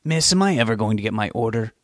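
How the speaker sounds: put-on mildly snappish old customer, leaning on EVER; a touch of testy impatience; snapped. A narrator's reading. Stodgy diner to her waitress, snapping. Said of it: "the stodgy old woman snapped".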